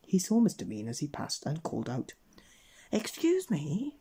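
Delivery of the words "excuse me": high, drawn-out call. A narrator's reading. Old man chopping wood hails Mister Mean, calling out. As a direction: hail a passer-by with stretched words. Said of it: "he called".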